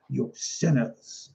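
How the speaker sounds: lightly drawn-out preacher's condemnation; a hint of slow preacher voice; Baptist preacher. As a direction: slowly intone it like a revivalist. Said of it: "that might be your Baptist".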